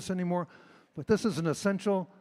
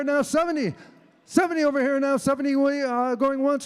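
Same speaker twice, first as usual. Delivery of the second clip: fast rhythmic auction chant; auctioneer; 6 semitones higher, 5 dB louder than usual. Acted. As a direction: rattle off bids, loud and high. Auctioneer bid calling.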